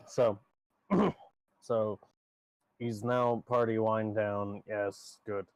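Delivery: normal talking voice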